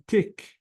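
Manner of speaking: "tick" as a detached syllable; separate syllable. Shown as a demonstration. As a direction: sound out "tick" on its own. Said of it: "is the second syllable".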